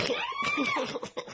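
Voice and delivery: sudden high cackle; high laugh. Real, not acted. Narrator laughing at his own dark joke, high cackle. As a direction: burst into a squeaky laugh.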